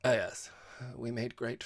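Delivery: vague, slowing assent; sketchily. A narrator's reading. Host answers about the pet, sketchily. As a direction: gloss over it airily. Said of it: "said Georgie, sketchily".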